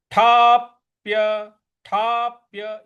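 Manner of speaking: ठाप्य with a slight push, drawn out; light stress on ठाप्य. Shown as a demonstration. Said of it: "हल्का सा बल लगाना है".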